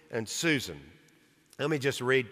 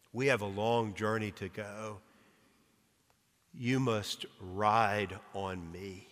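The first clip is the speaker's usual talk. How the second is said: Aslan, calm; 5 semitones lower, 7 dB quieter than usual. A narrator's reading. Aslan speaking in read story.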